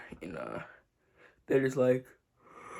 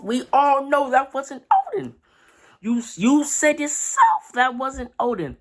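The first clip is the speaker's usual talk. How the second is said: louder, higher, disbelieving reprimand; exasperated scolding; 10 semitones higher, 5 dB louder than usual. Real, not acted. Fan scolding Kiku for trusting the fake, loud and exasperated. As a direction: chew out a character who should've known better.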